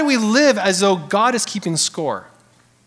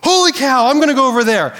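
high, loud "Holy cow" after a zap; jolted by a shock, then changing course; mock startled yelp; startled exclamation; 5 semitones higher, 4 dB louder, faster than usual. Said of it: "you're like".

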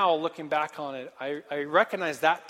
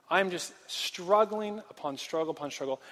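ordinary talk, nothing marked

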